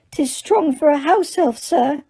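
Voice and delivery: high squeaky elf voice; Dobby the house elf. A narrator's reading. Dobby corrects Harry in a high elf voice. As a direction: pitch it up like a small elf.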